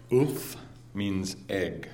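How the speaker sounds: slow, deliberate explaining; slow mock lesson; slow teaching. Shown as a demonstration. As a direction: spell it out slowly like a lesson. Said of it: "here's an example".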